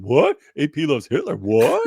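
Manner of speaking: incredulous rising questions; baffled newcomer. Acted. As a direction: blurt it in mock disbelief. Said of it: "someone jumping in there be like".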